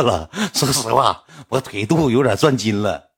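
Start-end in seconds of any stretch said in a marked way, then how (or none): none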